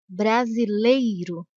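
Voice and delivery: brasileiro said slowly and clearly for the class; slow demonstration. Shown as a demonstration. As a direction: draw brasileiro out so the glide can be heard. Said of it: "E nessa palavra".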